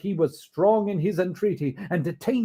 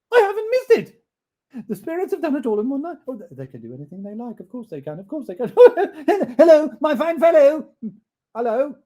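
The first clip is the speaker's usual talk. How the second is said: high, rushing, laughing; elated Scrooge; 9 semitones higher, 9 dB louder, faster than usual. Acted. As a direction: tumble out relief.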